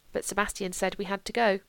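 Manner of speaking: ordinary delivery, nothing marked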